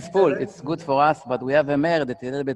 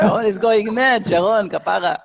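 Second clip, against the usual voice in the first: high, loud laughing words; 8 semitones higher, 9 dB louder than usual. Real, not acted. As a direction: laugh as you say it. Laughing at an excited viewer.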